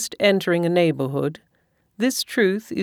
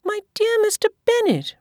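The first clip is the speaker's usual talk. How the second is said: much higher, exasperated wife; wife exasperated with her husband, much higher. A narrator's reading. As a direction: plead with a tiresome husband. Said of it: "replied his wife".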